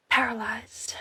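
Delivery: breathy whisper for effect; dramatic whisper. Acted. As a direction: let the word out as a hushed whisper. Dramatic word in story narration.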